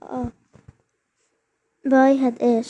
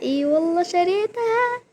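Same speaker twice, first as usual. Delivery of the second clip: higher-pitched excited disbelief; a real but contained excited claim; 6 semitones higher than usual. Real, not acted. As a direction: tell it with rising excitement. Repeats before the louder take.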